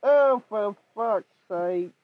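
normal talking voice

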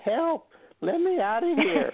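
high squeaky cry for help from a box; tiny trapped voice. Retold or imitated. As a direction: squeal it like a little prisoner. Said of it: "recording saying".

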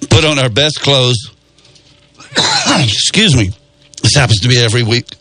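coughing through the words; a throat catch, coughing; choking. Real, not acted. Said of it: "I'm choking up because I'm choking".

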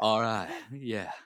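loud drawn-out actor catchphrase; Matthew McConaughey. Acted. Showing whom his villain voice resembled, actor impression. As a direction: put on an American actor's easy catchphrase. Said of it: "Matthew McConaughey".

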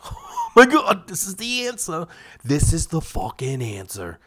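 high, laughing, slowed mock awe; mock amazed viewer. Viewer at home sold by the infomercial, mock awestruck. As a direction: gush like you've found the miracle fix. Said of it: "people would be sitting at home being like".

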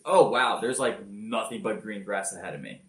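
ordinary talk

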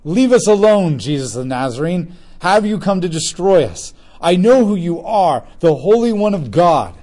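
high, loud shriek from a possessed man; reading scripture, the unclean demon shouts at Jesus; loud demon cry. Acted. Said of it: "he cried out with a loud voice".

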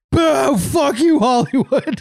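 long, high mock boo; drawn-out high jeer breaking toward a laugh; booing crowd. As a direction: heckle like an angry arena crowd. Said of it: "everyone was like".

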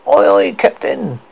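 put-on high, slow cabin-boy voice; eager young deckhand; boy's voice. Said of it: "answered the boy".

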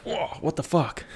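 high, startled yelp of confusion; startled whoa. Retold or imitated. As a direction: caught off guard on a dead escalator. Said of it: "you'll be like".

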